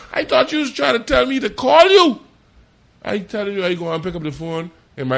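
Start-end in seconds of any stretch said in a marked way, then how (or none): 0.1-2.2 high and loud through laughter; laughing disbelief. Retold or imitated. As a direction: laugh at your own mix-up. Retells his misunderstanding, laughing.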